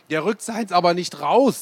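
put-on a boy grumbling about his friend; grumpy boy. A narrator's reading. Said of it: "sagte ich". (gespielter Junge, etwas höher und lauter)

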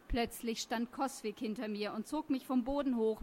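ordinary delivery, nothing marked

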